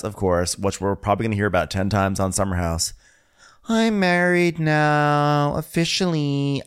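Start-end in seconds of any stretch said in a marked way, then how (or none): 3.7-6.6 high, slow mock announcement; Amanda. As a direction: draw it out high as proud news. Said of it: "then she tells us".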